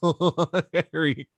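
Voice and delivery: laughing through the name; real laughter. Real, not acted. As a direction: say it while cracking up. Host cracking up before the count.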